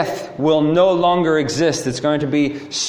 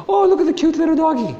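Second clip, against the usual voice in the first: put-on much higher, fast cooing baby talk to a puppy; 11 semitones higher, faster than usual. Parent and toddler cooing at a tiny tied-up dog, high baby talk. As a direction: coo at the little dog like a toddler would.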